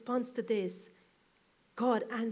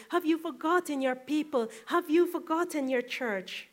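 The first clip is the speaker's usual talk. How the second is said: raised, pleading sermon cry to God; impassioned preaching; 4 semitones higher, 5 dB louder than usual. Acted. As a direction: lift the voice and plead with heaven.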